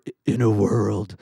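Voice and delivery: deep booming trailer announcer; movie trailer voiceover. Shown as a demonstration. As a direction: epic trailer voice, big and loud. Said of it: "movie trailer where it starts out with the voiceover".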